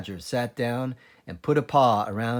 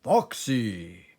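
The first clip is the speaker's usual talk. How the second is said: a touch of badger voice; 2 semitones lower than usual. A narrator's reading. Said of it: "cried Badger".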